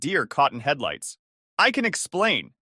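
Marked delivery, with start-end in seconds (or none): 1.6-2.5 nervous stammer. A narrator's reading. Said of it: "he stammered".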